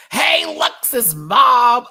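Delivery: loud, drawn-out high holler; calling out. Acted. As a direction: stretch the greeting into a big shout. Calling out to Lux's mom.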